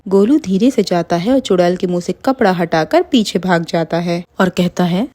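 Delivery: usual speaking voice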